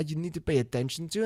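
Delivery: usual speaking voice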